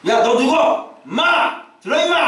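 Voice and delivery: a pastor berating someone on a broadcast, mock shouting; imitating a shouting pastor. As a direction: yell it like a bullying preacher. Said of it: "막 이러면서".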